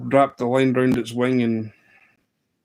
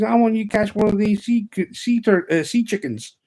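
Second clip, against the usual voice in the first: high-pitched imitated Southern boy; Southern US accent, pitched up; 10 semitones higher, 4 dB louder than usual. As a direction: insist in a put-on Southern accent. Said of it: "pure Southern boy accent".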